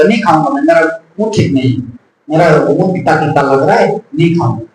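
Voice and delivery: low, grumbling refusal to eat; sulky sick patient. Acted. Sick family member refusing the meal, low and sulky. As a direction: grumble like a sick man who won't touch his food.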